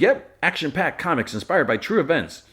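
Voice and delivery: high-pitched, hammed-up promo voice; mock sales pitch. Jimmy plugging his comics to Clark, hammed-up pitch. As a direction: pitch it like an eager self-promoter.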